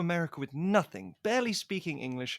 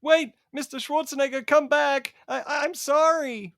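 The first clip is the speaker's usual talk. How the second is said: high, loud pleading call; shouted apology to a departing star; calling after him; 11 semitones higher, 9 dB louder than usual. Acted. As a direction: beg him to come back, loudly. Pleading after Arnold leaving.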